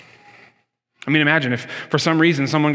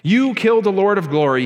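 raised-pitch, preacherly quote of an apostle; bold proclamation; 5 semitones higher, 3 dB louder than usual. Retold or imitated. Preacher quoting Peter's sermon at Pentecost, boldly proclaimed. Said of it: "just as boldly and just as clearly proclaimed".

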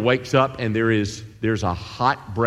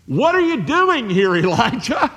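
high, loud, amused question; God asking playfully, pitched high; God's gentle question; 11 semitones higher, 4 dB louder than usual. Retold or imitated. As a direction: tease Elijah with a laugh. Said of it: "and he says".

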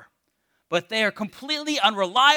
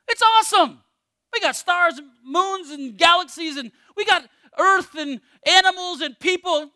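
excited; 7 semitones higher, 4 dB louder than usual. Pretend. Exults over God's creation.

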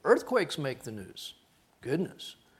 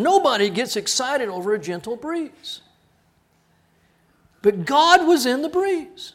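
higher, loud, slowing proclamation; full-force preaching; 9 semitones higher, 8 dB louder than usual. Acted. Preacher proclaiming God in the breeze, loud. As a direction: proclaim it to the back row.

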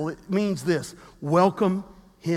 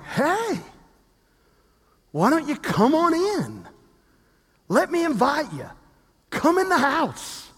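welcoming invite; 6 semitones higher than usual. Retold or imitated. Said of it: "you're like".

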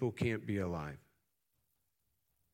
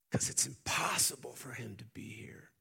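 whisper; 2 semitones higher, 3 dB quieter than usual. Acted. Angel voiced in sermon.

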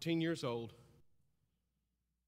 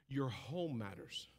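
normal talking voice

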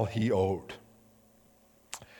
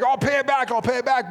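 much higher and louder, repeated in a rush; frantic promise; 11 semitones higher, 7 dB louder than usual. Acted. Servant frantically promising the king to repay. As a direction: blurt the promise desperately.

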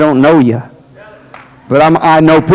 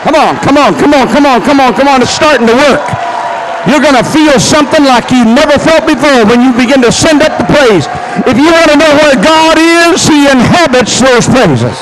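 preaching at full force; 11 semitones higher than usual. Acted. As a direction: lift the whole church with every line.

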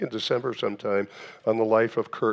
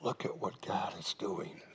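hushed, much softer reminder in the ear; whisper in the ear; 3 semitones lower, 12 dB quieter than usual. Acted. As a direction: murmur it close, like a voice at your shoulder. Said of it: "we need somebody to whisper in our ears".

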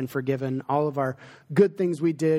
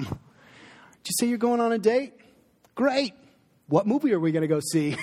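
mock excited dad; 7 semitones higher than usual. Pastor pretending to cheer his grounded teen about a night out. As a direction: ham up a dad thrilled about a date night.

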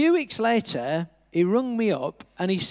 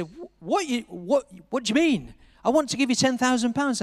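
mildly incredulous, pitched up; retold bafflement; 6 semitones higher than usual. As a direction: question it in higher-pitched disbelief. Retold puzzled reply to refusal.

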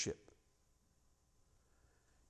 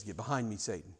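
"Get behind me, Satan" said low and much softer; quiet rebuke; 5 semitones lower, 8 dB quieter than usual. Acted. Jesus turns to Peter after weighing it, low and quiet. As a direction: say it low and soft, with heavy resolve. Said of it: "he looks at Peter and says".

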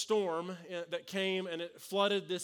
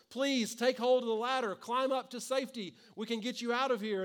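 higher, louder call down to the roof; shouting from above; 5 semitones higher, 3 dB louder than usual. Retold or imitated. Rescue workers shouting down from the helicopter, loud. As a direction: yell over the helicopter noise. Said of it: "rescue workers are shouting from the helicopter".

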